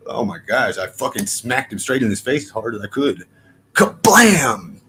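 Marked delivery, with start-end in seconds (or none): 3.7-4.8 loud, high mock impact noise; punch sound effect. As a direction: shout the smack like a cartoon hit. Retelling the punch he threw.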